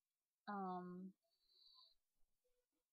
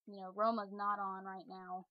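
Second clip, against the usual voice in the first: usual speaking voice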